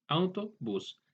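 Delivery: "autobús" broken into its parts, auto and bús; split into parts. Shown as a demonstration. Teacher showing how a compound word is formed, "autobús" said in parts. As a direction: separate the two words inside "autobús". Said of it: "al compuesto".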